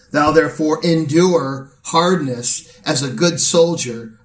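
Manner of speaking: steady pulpit preaching to the congregation. Acted. Pastor charging the church at an elder's ordination, in steady preaching voice. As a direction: deliver it as a sermon from the pulpit.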